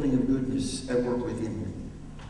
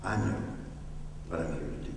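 calm inner resolve; 6 semitones lower, 6 dB quieter, faster than usual. Pretend. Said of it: "that says".

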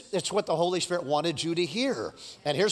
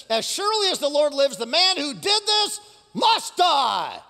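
burning anger; 7 semitones higher, 3 dB louder than usual. A narrator's reading. David passes sentence on the rich man, burning with anger. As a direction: thunder out the verdict, stretching the end. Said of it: "burned with anger against the man".

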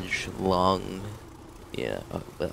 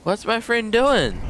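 surprised; 13 semitones higher, 4 dB louder than usual. Real, not acted. Sudden alarm at teammate.

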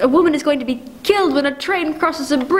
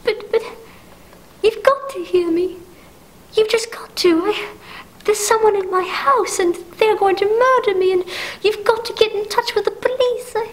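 higher, urgent, frightened pleading; desperate terror breaking through; rising panic; 7 semitones higher than usual. Acted. Invalid begging operator to call police, panicked. Panicked woman hears intruder.

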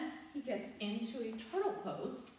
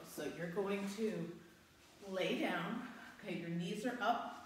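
ordinary talk

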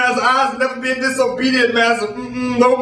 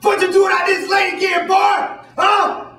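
master yelling; 9 semitones higher than usual. Acted. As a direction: bark it like a furious slave master. Master catches him again.

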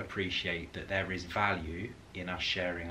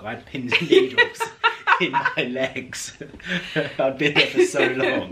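loud, high, laughing confession; 8 semitones higher, 13 dB louder than usual. Real, not acted. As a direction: protest loudly through laughter. Joking confession while both laugh.

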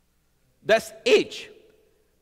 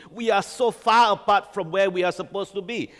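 usual speaking voice

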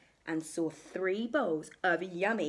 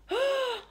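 sharp, high intake of breath; sudden acted gasp; shocked gasp; 4 dB louder than usual. Bears come home.

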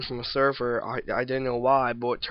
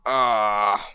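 short cry; 3 semitones lower, 7 dB louder than usual. Real, not acted. Scream as he dies.

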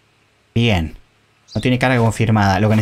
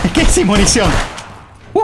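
panicked shout; 7 semitones higher than usual. Real, not acted. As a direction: yell it in alarm. Repeats louder in panic.

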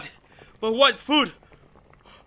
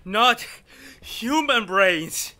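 high, drawn-out disbelief; horrified; 12 semitones higher, 5 dB louder than usual. Acted. Man learns the alien eats human brains, horrified. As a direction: recoil in horror.

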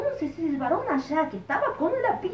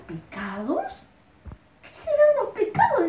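put-on puzzled boy; 7 semitones higher than usual. Boy Beto asks puzzled.

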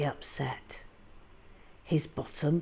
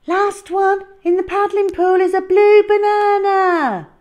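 very high, loud playground shout; shouted challenge; 15 dB louder than usual. Acted. Hal shouting a race dare, high and loud. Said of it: "shouted Hal".

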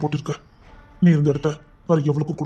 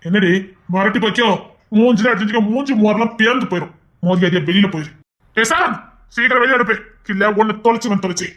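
angry shouting; 5 semitones higher, 4 dB louder than usual. Acted. Drives the woman out angrily.

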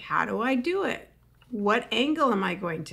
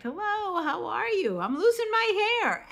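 higher, bright chirpy greeting that confesses a flaw; cheery greeting with a flaw; 10 semitones higher than usual. Shown as a demonstration. Comic showing corporate audiences a greeting, chirpy and higher. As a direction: greet them sing-song and admit a defect. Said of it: "and put in a defect".

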